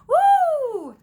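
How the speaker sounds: loud high gleeful whoop; squeal of delight. A narrator's reading. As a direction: let out a high, loud "woo". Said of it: "gave a squeal of delight".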